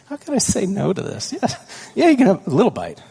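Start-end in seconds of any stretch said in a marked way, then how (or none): 1.3-3.0 higher, louder, quick indulgent yes; giving in. Retold or imitated. Father giving in to his son, brightly indulgent. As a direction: cave in happily to the kid.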